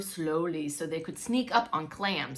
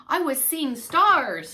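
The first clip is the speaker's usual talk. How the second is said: slow, louder, breathless from the dream; startled; 6 semitones higher, 8 dB louder, slower than usual. A narrator's reading. Spiny tells Grandma his dream, startled. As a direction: blurt it out just woken.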